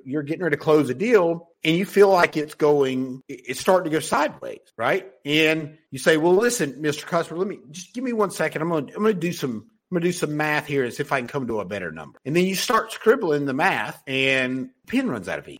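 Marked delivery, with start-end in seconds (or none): 6.1-12.2 quick, a bit softer, stalling the customer; hurried salesman. Retold or imitated. Salesman stalling a customer over the deal, quick and soft. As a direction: rush it like a salesman buying time. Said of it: "there's tonality that you have to listen to".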